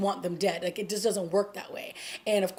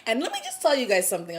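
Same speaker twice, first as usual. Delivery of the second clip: ordinary delivery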